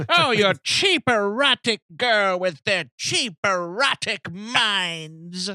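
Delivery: put-on high old lady, drawing out the last word; hammed-up disgusted elderly mother; Norma Bates. Mother condemning cheap girls, scolding old lady voice. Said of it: "with this great old lady voice".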